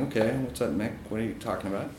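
softly imitated smirk, half under the voice. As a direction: play it back quietly, amused and doubtful. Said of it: "I'm kinda smirking".

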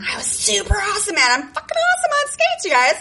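hammed-up pride in her skating; mock bragging. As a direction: brag like you own the rink.

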